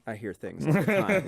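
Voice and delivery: lightly put-on casual admission; offhand mock version. Showing how the maintenance man did not say it, offhand. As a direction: say it openly, with no eerie hint. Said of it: "It wasn't like".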